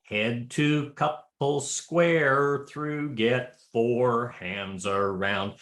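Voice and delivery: very slow, dragged-out square dance call; stretched-out call. Shown as a demonstration. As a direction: stretch each word of the call way out. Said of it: "unless somebody's going".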